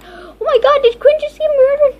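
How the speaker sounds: high, loud, fast disbelieving cry; shocked shriek. Real, not acted. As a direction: blurt it out in horror. Shocked friend may be killed.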